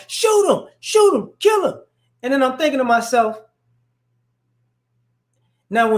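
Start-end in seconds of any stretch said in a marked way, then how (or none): 0.1-1.8 high mock shouts urging the cops on; egging on. Retelling a bystander yelling at the police to shoot. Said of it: "he instigating for the guy".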